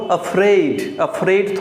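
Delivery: "afraid" slow, the ai held long; drawn-out vowel. Shown as a demonstration. Teacher telling students to lengthen "afraid". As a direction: stretch the vowel in "afraid", don't finish fast. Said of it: "थोड़ा लंबा जाता है".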